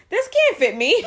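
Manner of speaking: high-pitched mock complaint; puzzled mother. Mother handed a baby onesie, imitated puzzlement. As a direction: imitate her protest, pitched right up.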